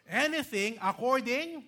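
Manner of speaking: "Anything" punched high and loud; stress on anything. Shown as a demonstration. Pastor pointing out the qualifier, stressed "Anything". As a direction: hit "Anything" hard and high. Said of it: "look at the qualifier".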